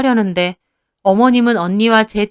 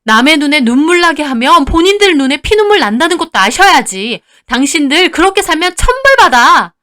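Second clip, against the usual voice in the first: high, loud angry warning; fierce denunciation; 6 semitones higher, 7 dB louder than usual. Acted. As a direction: sister condemning the in-laws. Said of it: "어머님을 쏘아보며 말했습니다". (쏘아붙이며 저주하듯 경고하는 언니)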